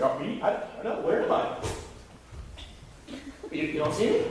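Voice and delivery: playful, partly whispered innocence; sarcastic God. Retold or imitated. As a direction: feign not knowing where he is, teasing. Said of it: "He's like".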